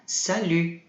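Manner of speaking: "salut" with a rounded French U and no final T; slow "salut", lips rounded on the U; teacher models "salut" for students. Shown as a demonstration. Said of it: "A gente fala U".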